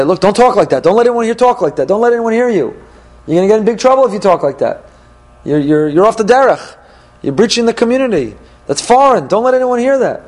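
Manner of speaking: high-pitched, urgent hushing warning; alarmed scolding. Acted. Friend warning the enticer not to be heard, urgently scolding.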